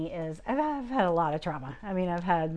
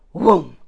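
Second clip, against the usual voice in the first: sudden booming "whoom"; loud whoosh noise; 13 dB louder than usual. Shown as a demonstration. Speaker showing how grace arrived, sudden whoom. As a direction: imitate a rush with one loud burst. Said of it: "it was literally like".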